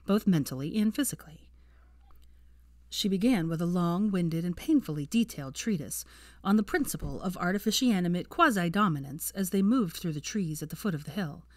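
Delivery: ordinary delivery, nothing marked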